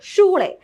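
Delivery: "ഷൂളെ" as shoo-leh, starting with sh; SH sound. Shown as a demonstration. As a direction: open "ഷൂളെ" on the sh of shoes. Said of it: "ഷ് എന്നുള്ള sound ആണ്". ("ഷൂളെ" ഷ് ശബ്ദത്തിൽ തുടങ്ങി)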